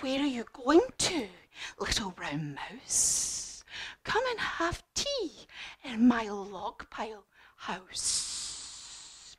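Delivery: slow, soft, hissing snake; hushed, drawn-out snake invitation; slinky inviting snake. Acted. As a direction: stretch the words and sibilants like a snake. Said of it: "what did the snake say".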